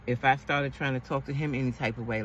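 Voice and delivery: ordinary talk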